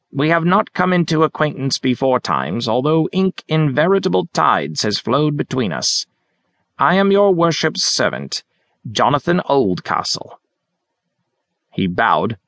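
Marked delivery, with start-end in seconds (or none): 0.1-10.4 put-on higher, theatrical old gentleman; bow and declaim grandly; hammy Shakespearean actor. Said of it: "He had a broad accent".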